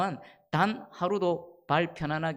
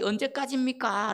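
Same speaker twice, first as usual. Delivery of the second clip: lamenting cry; 5 semitones higher than usual. Acted. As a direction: stretch the question into a plea. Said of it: "라고 하나님께 탄식했던".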